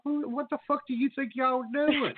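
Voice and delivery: high, indignant challenge to line cutters; retold angry outburst. To two women cutting the wake line, an indignant outburst. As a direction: snap at them like they've lost their minds. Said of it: "and I'm like".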